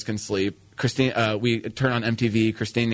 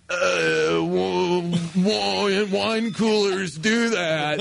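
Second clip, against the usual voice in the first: high, slow, stammering fake drunk; acting drunk; 6 semitones higher, much slower than usual. Son fakes drunkenness to mom, hammed-up drunk stammer. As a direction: stall and drag it out like a bad drunk. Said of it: "Time to act like you're drunk".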